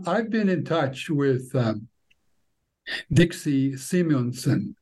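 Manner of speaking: ordinary delivery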